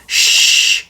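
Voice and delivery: sharp unvoiced shush; hissed shush. A narrator's reading. Judy warning Paddington, a hissed shush. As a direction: hush them at once. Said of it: "hissed Judy".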